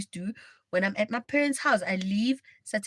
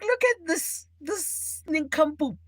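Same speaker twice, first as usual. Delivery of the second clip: scornful; 7 semitones higher, slower than usual. Retold or imitated. Mocking her passed-out boyfriend, high and scornful. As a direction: sneer down at him. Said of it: "I'm like".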